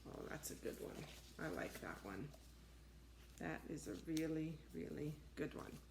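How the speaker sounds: hushed, breathy praise; soft aside. Real, not acted. Closing the book for the children, soft aside. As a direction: murmur it to yourself. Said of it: "hushed comment after book".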